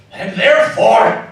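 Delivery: the same words now loud and booming; and therefore, thrown out at full voice; loud reveal build-up. Acted. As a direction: hold it back, then burst out with it. Scrooge building to the surprise.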